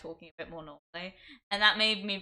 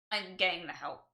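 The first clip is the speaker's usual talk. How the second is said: ordinary talk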